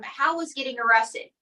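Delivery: ordinary talk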